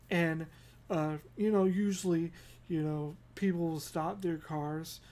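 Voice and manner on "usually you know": normal talking voice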